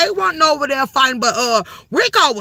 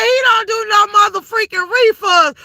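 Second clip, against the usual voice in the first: mock Pastor; 7 semitones higher than usual. Imitating Pastor refusing refunds.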